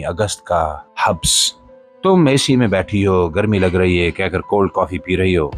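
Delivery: ordinary talk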